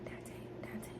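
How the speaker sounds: soft unvoiced whisper calling the name. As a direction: hiss the name almost silently. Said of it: "I can just hear him like".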